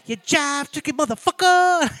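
jive turkey screamed high and loud; shrieked take. Retold or imitated. Teacher showing how to say it, shrieking.

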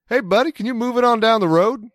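put-on sleepy Maryland accent gone Southern, calling down from the window; Southern-tinged Maryland accent. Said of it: "leaned into my Maryland accent ... way more Southern than I intended".

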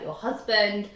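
ordinary talk, nothing marked

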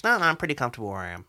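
fast, offhand racing driver on radio; Lewis radio impression. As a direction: brush it off quickly, unbothered. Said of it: "When he wouldn't give up to Rosberg".

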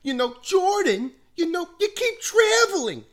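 very high, loud scolding teacher voice; mock-furious assistant coach chewing out the team; Mister Weiss impression. Assistant coach scolding players at halftime, high loud mock anger. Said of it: "he's like".

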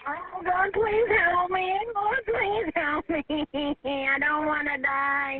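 real slow wailing sobs; crying plea. Real, not acted. Drowning caller prays for help, crying plea. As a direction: beg through tears, dragging every word. Caller begs God for rescue.

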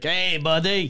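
mock French Canadian buddy greeting; French Canadian accent. Seller mirroring a French Canadian customer, mock French Canadian accent. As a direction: put on a Québécois accent. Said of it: "like, a French Canadian, you'd be like".

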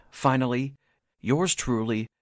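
usual speaking voice

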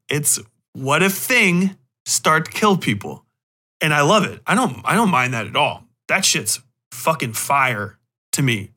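usual speaking voice

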